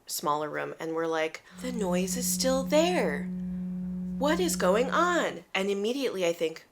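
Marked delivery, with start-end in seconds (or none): 1.6-5.4 slow, high-pitched disbelief; dramatic retold bewilderment; exasperated dismay. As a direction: draw it out in baffled dismay, pitch swooping up. Acting out their dismayed discovery.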